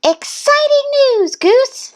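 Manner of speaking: very high, excited duck; Duck's excited voice. A narrator's reading. Duck answering Goose's news, high and excited. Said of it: "said Duck".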